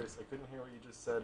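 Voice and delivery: fast hushed whisper. Real, not acted. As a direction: whisper it quickly. Whispering to the caller.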